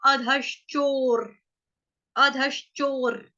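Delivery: अधश्चोर slow, with the र placed after शो; slow demo. Shown as a demonstration. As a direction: say अधश्चोर slowly, the र at the end. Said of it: "रफार वाले व का उच्चारण होगा".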